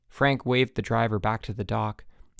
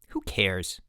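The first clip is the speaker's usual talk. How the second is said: sneering; 3 semitones lower, 3 dB quieter than usual. A narrator's reading. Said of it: "sneered Harold Green".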